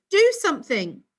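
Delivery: high, loud urgent demand. A narrator's reading. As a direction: push him to act now. Sue panics at Ricky.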